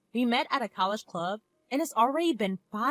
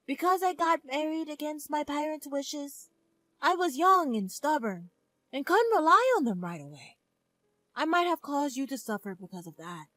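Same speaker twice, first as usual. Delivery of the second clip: higher, gentle mother voice; 6 semitones higher than usual. Acted. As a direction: speak as a regretful mother.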